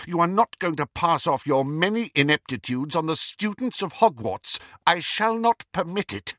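high, indignant insistence; outraged but controlled teacher; stern refusal. A narrator's reading. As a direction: forbid it firmly, rising at the end. Professor firmly forbids his lie.

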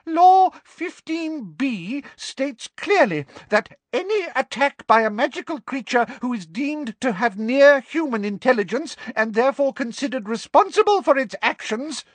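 very high, officious recital; frightened official laying down rules; high-pitched, terrified. A narrator's reading. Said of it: "in a high-pitched, terrified voice".

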